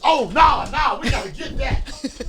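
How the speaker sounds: loud, high, laughing mock grandma; grandma scrambling. Grandma grabbing fallen money, loud excited mock voice. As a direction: play grandma diving for cash. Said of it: "She did like this".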